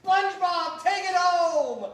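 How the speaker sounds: loud and drawn out slowly; hyped announcer. Real, not acted. Host announcing the game's star to the crowd, hyped and slow. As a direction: hype it up like a showman.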